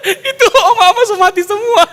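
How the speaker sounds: high, laughing cheeky retort. Retold or imitated. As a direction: blurt it out through laughter. Retelling Ungke's cheeky retort, laughing. (membalas nenek sambil tertawa dengan suara tinggi)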